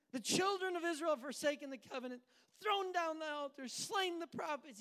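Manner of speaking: high, loud list of grievances; frustrated complaint. Acted. Elijah reporting Israel's sins to God, loud frustration. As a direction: protest each wrong, aggrieved. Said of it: "when we get frustrated with God".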